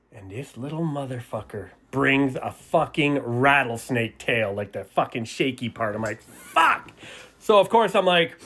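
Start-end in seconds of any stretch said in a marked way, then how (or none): 6.2-6.9 sudden high yelp of dismay; dismayed outburst. Retold or imitated. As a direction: blurt it out like a kid who just lost. Dismayed at rival's better item.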